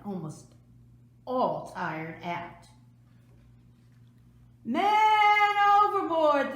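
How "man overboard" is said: high, loud, slow alarm shout; crying out. A narrator's reading. Sailors spot George in the water, shouting the alarm. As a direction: sound the alarm across the ship. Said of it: "the sailors cried".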